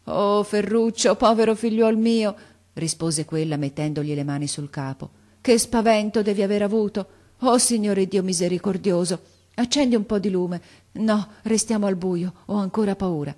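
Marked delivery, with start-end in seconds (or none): 0.1-2.4 distressed grandmother. A narrator's reading. Grandmother pities her grandson. 5.4-13.3 agitated, raised, higher voice; frightened grandmother. A narrator's reading. Frightened grandmother after the attack.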